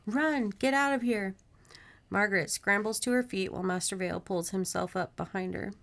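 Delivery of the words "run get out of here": high, restrained yell; urgent yell. A narrator's reading. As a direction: urge someone to flee, pitched high. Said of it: "she yells to Catherine".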